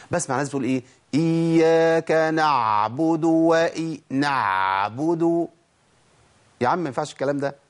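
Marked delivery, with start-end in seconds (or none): 1.1-5.5 العين في "إياك نعبد" مضغوطة بمبالغة وممطوطة ببطء; exaggerated ain. Shown as a demonstration. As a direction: overdo the ain in نعبد, slow and strained. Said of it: "المبالغة في صوت ال-، العين". (عين مبالغ فيها ببطء)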